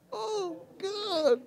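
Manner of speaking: high, drawn-out fake moan; mock wailing. Struggling woman crying to God, mock wail. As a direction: wail it high like someone hammed-up despairing. Mimics a person wailing in distress.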